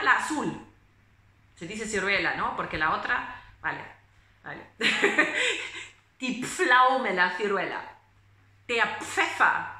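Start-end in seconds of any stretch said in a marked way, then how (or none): 4.7-5.9 burst of laughter. Real, not acted. Laughs at own slip.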